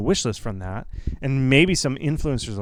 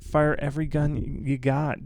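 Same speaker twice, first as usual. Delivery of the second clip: ordinary delivery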